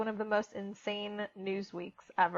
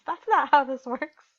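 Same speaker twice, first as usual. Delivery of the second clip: friend's retort; 5 semitones higher, 7 dB louder than usual. Retold or imitated. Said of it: "her friend says".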